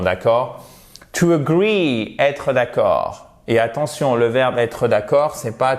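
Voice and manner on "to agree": slow model "agree" with a long ee; stress on second syllable. Shown as a demonstration. Teacher modelling the verb for French learners, stressed second syllable. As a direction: agree stressed and drawn out on -GREE. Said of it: "où se trouve l'accent tonique".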